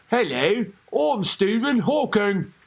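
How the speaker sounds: exaggerated British machine voice; slow, hammed-up British accent; mock British accent. Host imagining Hawking's machine, mock British accent. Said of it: "would want a British accent in a stupid machine".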